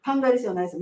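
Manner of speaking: ordinary talk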